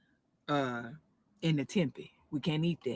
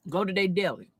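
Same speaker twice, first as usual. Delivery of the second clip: ordinary delivery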